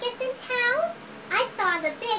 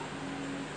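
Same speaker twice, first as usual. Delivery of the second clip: giggle; 15 dB quieter than usual. Acted. Giggle after elephant.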